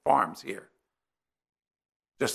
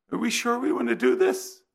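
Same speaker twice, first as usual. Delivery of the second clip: higher, doubting plea in the wife's voice; worried wife; 9 semitones higher, 3 dB louder than usual. Retold or imitated. Wife in the scorching car asking to turn around, higher anxious plea. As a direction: voice the wife's nervous second thoughts, pitched up.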